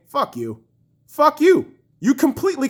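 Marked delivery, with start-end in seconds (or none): 0.1-0.6 quiet, low-pitched dismissal; low angry retort. Real, not acted. Fan answering excuses for the goalie, low dismissive anger. 1.1-1.7 higher, punched-up fury; raised angry retort. Real, not acted. Fan repeating the retort to the goalie, raised anger.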